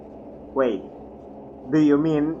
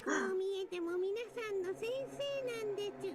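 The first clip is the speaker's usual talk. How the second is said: high giggle; 14 dB quieter than usual. Acted. Plush character laughing.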